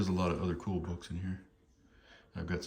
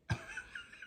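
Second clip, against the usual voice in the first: laughing; 8 dB quieter than usual. Real, not acted. Laughs at joke book page.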